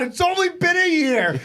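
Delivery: high, drawn-out mock shout with laughter; imitated heckler's scream. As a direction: yell it out like a crowd heckler. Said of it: "And somebody screams out".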